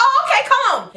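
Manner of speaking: high, squeaky mock politeness; put-on high agreeable voice; fake-cheerful reply. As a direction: answer in an exaggerated high chirp. Acted reply when caught sneaking.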